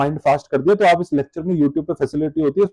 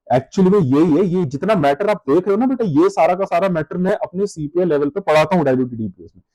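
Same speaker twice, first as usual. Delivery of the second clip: ordinary delivery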